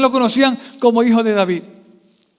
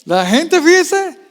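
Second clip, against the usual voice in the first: ordinary talk, nothing marked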